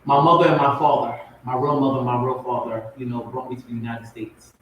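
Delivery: ordinary delivery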